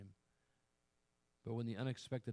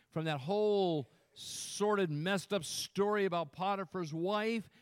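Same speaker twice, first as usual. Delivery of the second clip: raised voice, stretched key words; fervent preaching; 7 semitones higher, 7 dB louder than usual. Real, not acted. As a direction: build the list with pulpit fervor. Preaches at full force.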